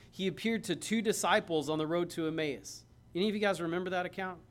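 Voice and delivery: normal talking voice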